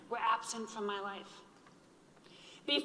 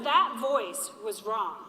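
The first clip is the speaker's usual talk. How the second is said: slow and louder, each word weighted; firm refrain; 6 dB louder, slower than usual. Real, not acted. Survivor defying her inner doubt, slow and firm. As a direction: lay it down like a verdict.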